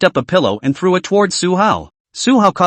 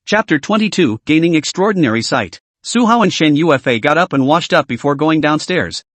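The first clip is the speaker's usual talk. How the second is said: ordinary delivery, nothing marked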